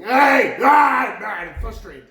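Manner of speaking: loud, high tantrum yelling; mad, frustrated kid. Acted. Imitating a frustrated kid, shouting mad. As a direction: throw a little kid's tantrum, then ease off. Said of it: "like a little kid".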